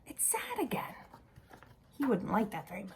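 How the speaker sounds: soft whispered explanation; hushed, confiding whisper. Real, not acted. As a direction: whisper it gently to the little ones. Hushed remark to children.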